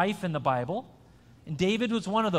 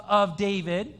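ordinary delivery

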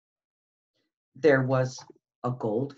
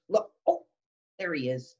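usual speaking voice

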